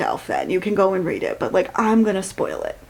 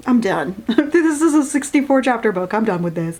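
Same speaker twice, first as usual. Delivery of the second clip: ordinary talk, nothing marked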